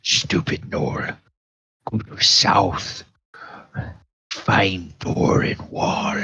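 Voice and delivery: soft, breathy broken-speech taunt; mocking the gnoll's doom in a hush; sneering bugbear. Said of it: "the bugbear says".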